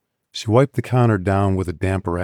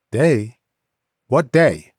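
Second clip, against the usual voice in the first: sharp, louder demand; sharp question; 5 semitones higher, 4 dB louder, slower than usual. A narrator's reading. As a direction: snap the question back at her. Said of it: "he said sharply".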